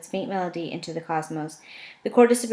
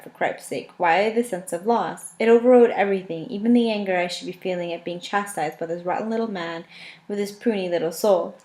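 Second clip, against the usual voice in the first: plain reading voice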